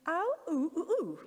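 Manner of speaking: imitating vowel-only speech, pitched high; Louis. Parent showing the audience how Louis talks, imitated vowel sounds. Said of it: "he'd say".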